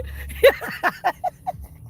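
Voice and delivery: very high, airless wheezing laugh; real helpless wheezing laugh; breathless high laugh. Real, not acted. Laughing at everyone crying.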